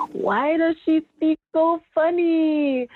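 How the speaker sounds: high, drawn-out wondering question; mock child's question. Kids asking about their little cousin, high drawn-out puzzlement. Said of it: "they would say".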